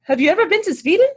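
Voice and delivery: quick, high Swedish lady; Swedish accent. Retold or imitated. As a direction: ask brightly in a put-on Swedish accent. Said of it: "try to do a Swedish accent".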